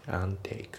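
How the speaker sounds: "and take" as "an take"; dropped D. Shown as a demonstration. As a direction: leave the D out of "and take". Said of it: "Dが抜けますね".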